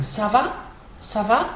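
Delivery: "Ça va" rising sharply at the end; questioning intonation. Shown as a demonstration. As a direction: ask "Ça va" with the tone going up on va. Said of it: "put the question in your voice".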